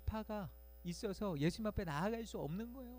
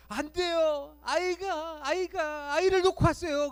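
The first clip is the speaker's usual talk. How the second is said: much higher, loud, desperate cry; a mother who left her child behind; anguished scream; 11 semitones higher, 9 dB louder, slower than usual. Retold or imitated. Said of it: "절규하면서". (절규하는 엄마)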